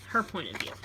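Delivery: ordinary delivery, nothing marked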